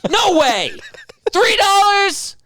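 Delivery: stingy tourist balking at cheap juice, shrieking high and slow; mock outraged haggler. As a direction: squeal in hammed-up disbelief at the price.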